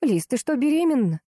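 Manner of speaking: taken aback, voice lifted; stunned. A narrator's reading. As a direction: ask as it suddenly dawns on you. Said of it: "спросила ошарашенно".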